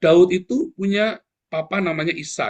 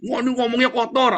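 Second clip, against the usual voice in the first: mengejek penuduh; 9 semitones higher, 4 dB louder than usual. Retold or imitated. Mimics his accusers' complaint.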